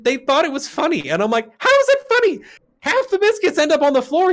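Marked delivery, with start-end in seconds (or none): 1.6-4.3 very high-pitched indignant retelling; incredulous protest. Retold or imitated. Retold argument about thrown biscuits.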